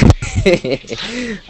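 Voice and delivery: high giggling laugh; burst of laughter. Real, not acted. Laughs reading email.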